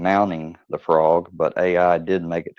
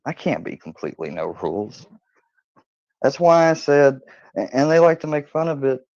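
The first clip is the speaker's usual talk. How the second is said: usual speaking voice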